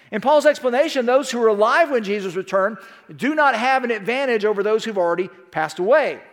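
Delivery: ordinary talk, nothing marked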